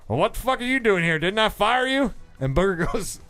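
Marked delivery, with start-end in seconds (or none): 0.1-2.1 lightly acted, higher-pitched disbelief; exasperated boss. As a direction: imitate a boss confronting a fired employee. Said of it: "the boss looks at Booger and goes".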